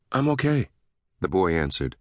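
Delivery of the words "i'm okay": put-on higher, light child's answer; a small boy reassuring his dad; a hint of a young boy; lightly voiced boy. A narrator's reading.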